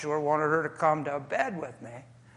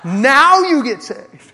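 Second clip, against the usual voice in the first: high, slow, loud laughing retort; indignant wife mocking her husband's conversion, loud laughing retort; 7 semitones higher, 15 dB louder, much slower than usual. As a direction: sneer it out through a laugh. Wife's indignant retort, mocked.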